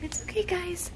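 high, soft reassurance. Real, not acted. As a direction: gently settle them down. Soothing the animals.

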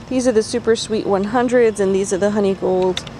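ordinary talk

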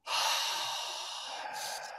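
long, soft breathy exhale; deep demo exhale. Shown as a demonstration.

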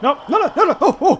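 frantic high shouts; panicking gamer. Real, not acted. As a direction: panic at a near miss. Near miss with monster.

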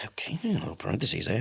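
breathy whisper under his breath. Real, not acted. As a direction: murmur it just above a breath. Reading quietly to himself.

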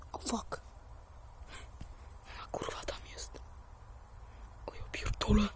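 frightened whisper, breath only. Real, not acted. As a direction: whisper so she won't hear. Hiding from the neighbour.

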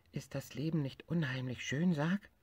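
marveling at life; happy little tiger. Acted. Tiger asks bear if life isn't lovely.

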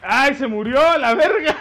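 loud, laughing panic shout; panicking gamer. Real, not acted. Character dies in game.

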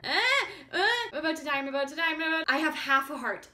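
high, loud panicked cries about dying; panicked screaming. Real, not acted. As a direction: yell like the monsters have you cornered. Near death in game.

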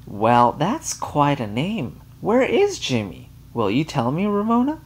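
Mary Jane gushing over Ramona; gushing to child. A narrator's reading. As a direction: talk down sweetly to a child.

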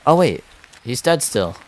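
high, loud burst of surprise; surprised. Real, not acted. Player spots that he's dead still, surprised. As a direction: blurt it out, caught off guard.

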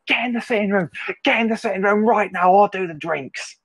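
high, urgent yelled commands; screaming orders. Retold or imitated. To drunk dad holding a boiling kettle, high panicked yelled orders. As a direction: order them out of the kitchen, panicked. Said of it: "I'm literally screaming".

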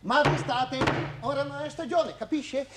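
high-pitched indignant outburst; strident scolding. Acted. Landlady telling a guest it is her house, strident scolding. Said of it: "Ma non strillate così!".

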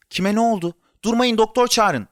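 high, urgent onlooker's voice; alarmed. A narrator's reading. Crowd in the lobby around Kerem, alarmed. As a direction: urge everyone to act at once.

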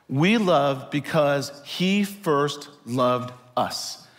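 slow, measured scripture quote; scripture reading. Acted. Pastor reading First John to the congregation, slow and measured. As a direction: let each word of the verse land slowly. Reads a Bible verse.

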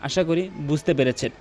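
ordinary delivery

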